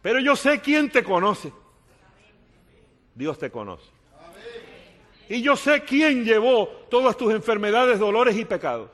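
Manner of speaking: loud, high proclaiming; a big rising pulpit cry; preaching at full force. Acted. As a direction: thunder it out to the congregation. Preaching climax.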